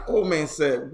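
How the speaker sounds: usual speaking voice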